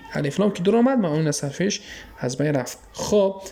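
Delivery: ordinary delivery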